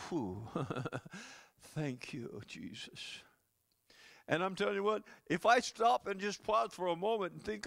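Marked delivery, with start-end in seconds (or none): 0.1-3.2 relieved sigh. Retold or imitated. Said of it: "you're gonna go".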